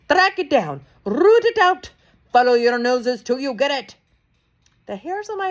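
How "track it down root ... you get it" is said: much higher, loud screamed order. A narrator's reading. Grand High Witch screams orders at the witches, high and loud. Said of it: "screamed the Grand High Witch".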